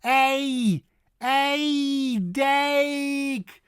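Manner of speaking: high, stretched ei in A and dike; exaggerated dike, near "dake"; vowel drill. Shown as a demonstration. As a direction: hold the Dutch A sound, then dike. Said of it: "it's dike".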